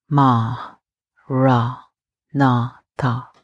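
"Ma, ra, na, tha." each syllable spaced apart with equal weight; "Ma, ra, na, tha." slow, separated, evenly stressed syllables; four equally stressed syllables. Shown as a demonstration. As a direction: say "Ma, ra, na, tha." one syllable per breath, all stressed alike. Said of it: "It's four equally stressed syllables".

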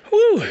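high, loud "woo" yell; excited whoop. Real, not acted. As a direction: let out a whoop of effort. Hard workout on the elliptical.

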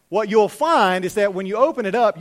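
usual speaking voice

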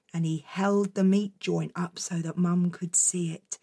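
hushed storyteller whisper. A narrator's reading. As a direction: breathe it in a whisper. Whispered narration.

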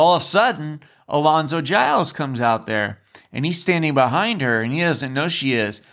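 usual speaking voice

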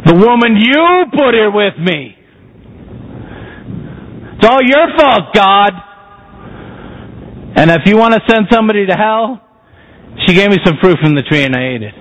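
high whiny blame of God; blaming Adam. Acted. Adam blames the woman and God, high and whiny. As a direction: push the fault onto God, then rush. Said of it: "The man said".

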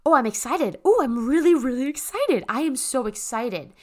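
bubbly excited self-talk demo. Shown as a demonstration. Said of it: "start to say".

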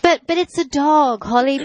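high, scoffing owner voice; mock the careless owner; dismissive owner. Mimicking dismissive dog owners.

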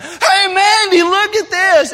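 loud, high call across the water; yelling across a pond. Retold or imitated. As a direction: call out to someone far away. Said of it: "we're yelling across this pond".

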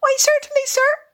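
very high, tiny polite voice; respectful field mouse. Acted. Field mouse answers the Rat, respectful squeaky little voice. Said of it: "replied the field mouse respectfully".

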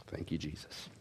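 very low, barely audible prayer; deep drop to a soft prayer; hushed thanks. Real, not acted. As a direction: murmur thanks to God privately. Brief thanks aside to God.

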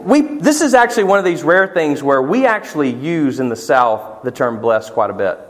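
ordinary delivery

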